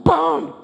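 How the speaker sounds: loud rising whoosh, then a booming hit; imitated launch noise. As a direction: mimic the coaster firing off. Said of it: "that thing goes".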